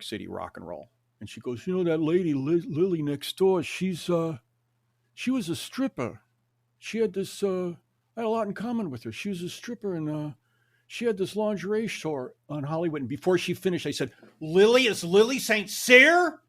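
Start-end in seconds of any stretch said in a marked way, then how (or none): 1.7-13.0 lightly voiced girlfriend, higher and chattier; a hint of a gossipy girlfriend, pitched up; girlfriend's voice. 14.4-16.4 much higher, louder excited blurt; excited disbelief. Retold or imitated. Excited recognition of famous name.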